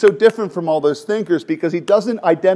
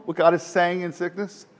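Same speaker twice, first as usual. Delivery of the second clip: ordinary delivery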